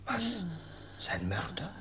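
urgent unvoiced whispering; hushed whispers. The men at the deathbed whisper about the murderer: hushed whispers. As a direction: whisper the suspicion so nobody hears.

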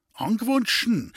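high startled question, falling at the end; exclaiming. A narrator's reading. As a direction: exclaim it in disbelief. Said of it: "rief Friedel".

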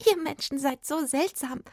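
high fairy voice after a little giggle; giggle, then speak in a tiny high voice; amused, high-pitched fairy; giggling little fairy. A narrator's reading. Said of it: "kicherte die Fee".

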